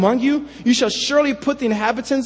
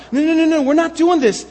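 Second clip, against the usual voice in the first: higher, louder, anxious no no no; anxious protest; 6 semitones higher, 3 dB louder than usual. Retold or imitated. Tribes anxiously denying idolatry to their brothers, higher and louder. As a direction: protest in a rush of worry. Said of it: "you could see the anxiety".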